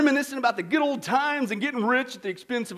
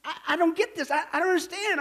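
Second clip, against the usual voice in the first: lightly stammered, higher confused politician; a mild stammer of disbelief; 6 semitones higher than usual. Acted. Confused politician stammering to the devil, mild stammer. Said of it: "he stammers".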